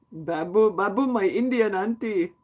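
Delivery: put-on slow, drawn-out Indian accent. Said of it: "shouldn't be doing an Indian accent".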